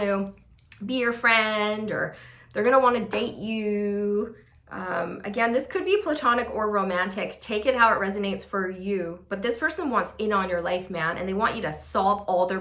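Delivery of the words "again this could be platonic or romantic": ordinary talk, nothing marked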